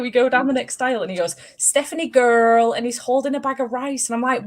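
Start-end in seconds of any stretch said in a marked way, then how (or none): 1.6-2.7 put-on toddler voice. Imitating toddler calling her.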